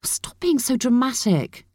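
high, angry rebuke; exasperated. A narrator's reading. Aunt struggling with niece.

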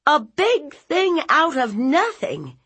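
high, loud incredulous echo; outraged aunt. Acted. Aunt throwing nephew's words back, outraged. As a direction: hurl the phrase back in outrage.

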